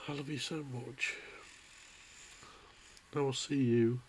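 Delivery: real crying. Real, not acted. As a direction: sob through the goodbye, voice cracking. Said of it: "I'm getting a bit upset again".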